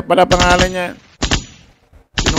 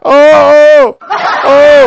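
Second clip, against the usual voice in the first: high loud laughing yes; 12 semitones higher, 10 dB louder, much slower than usual. Real, not acted. Host agreeing with the caller, laughing high and loud. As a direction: burst out laughing the yeses.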